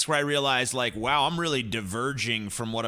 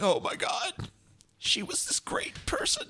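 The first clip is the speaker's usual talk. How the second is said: mocking mourners; 12 semitones higher, 5 dB quieter than usual. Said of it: "they acted like".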